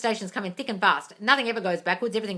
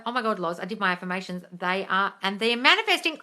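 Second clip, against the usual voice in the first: ordinary delivery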